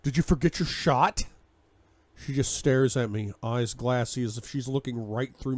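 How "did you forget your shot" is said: sharp angry question, louder and higher; angry demand. Acted. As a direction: demand an answer. Furious accusation at Anna.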